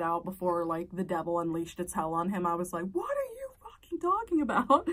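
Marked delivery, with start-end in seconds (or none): 2.9-4.9 very high-pitched incredulous snap; retold furious outburst. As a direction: imitate yourself blowing up at your husband. Snapping at husband, devil unleashed.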